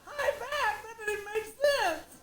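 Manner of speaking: high, slow, loopy laugh-talk; woozy high giggling; drugged giggling. Retold or imitated.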